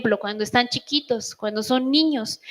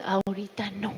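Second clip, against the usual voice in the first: softer, gentle hold-off; soft refusal; 3 semitones lower, 9 dB quieter than usual. Retold or imitated. Mother telling kids not now, softer and calm. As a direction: decline calmly, low-key. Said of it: "Prefiero mejor decirles".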